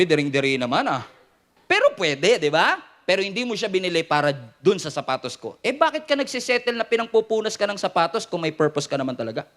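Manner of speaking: usual speaking voice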